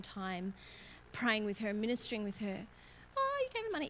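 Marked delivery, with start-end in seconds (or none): 3.2-3.9 high, incredulous question; mock surprised disbelief; surprised questioner. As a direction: sound shocked she gave money. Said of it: "some people might go".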